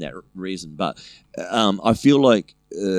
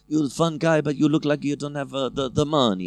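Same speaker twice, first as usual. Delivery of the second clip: put-on higher, halting broken English with Italian accent; 6 semitones higher than usual. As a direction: imitate a flirty Italian woman's accented English. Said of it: "Nice accent, by the way. She had real bad English".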